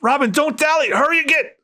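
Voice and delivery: high pleading mother's voice; desperate mother. Acted. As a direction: beg the child to run. Mother urging Robin to flee.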